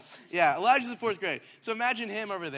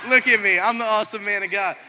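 mock bragging; 3 semitones higher, 11 dB louder than usual. Kid boasting to the class.

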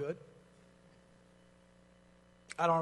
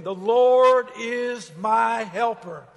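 slow, very loud and high; loud group declaration; 4 semitones higher, 14 dB louder, much slower than usual. Shown as a demonstration. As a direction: lead the congregation in it. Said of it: "Say it again".

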